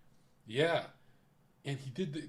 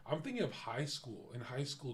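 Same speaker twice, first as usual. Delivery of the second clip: normal talking voice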